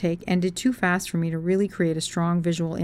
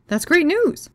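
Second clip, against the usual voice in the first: much higher, bright burst of praise; forced cheer; 10 semitones higher, 4 dB louder than usual. Retold or imitated. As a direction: pitch it up to sound glad. Forced cheer at his job news.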